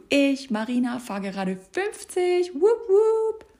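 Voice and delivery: high, loud triumphant cheering; giddy cheer. Retold or imitated. Coach playing herself at fifty km/h, cheering loudly. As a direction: crow with pride at doing fifty. Reliving joy of first driving lesson.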